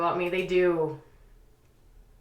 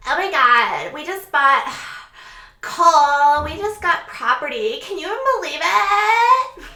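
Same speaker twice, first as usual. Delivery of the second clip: high, loud gushing whine; whiny Chelsea; 8 semitones higher, 8 dB louder than usual. Retold or imitated. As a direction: whine about the new property to Cole. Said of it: "she's like".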